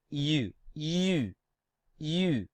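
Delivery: U through almost clenched teeth; French U. Shown as a demonstration. Teacher shows learners how to try saying U, teeth almost clenched. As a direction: say U with teeth nearly closed. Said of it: "keep our teeth almost clenched".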